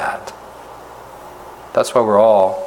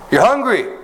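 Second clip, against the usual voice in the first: emphatic exclamation; 12 semitones higher than usual. Real, not acted. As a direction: jolt the listeners awake. Preacher emphasizes hunger.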